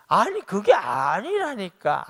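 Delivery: slow, loud, high protest; protesting cry. Retold or imitated. Job loudly protesting to accusing friends, slow and high. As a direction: plead your innocence slowly and loudly.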